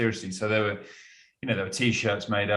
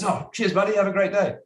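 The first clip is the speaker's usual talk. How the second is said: cheery fan; 12 semitones higher, 5 dB louder than usual. Retold or imitated. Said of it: "He goes".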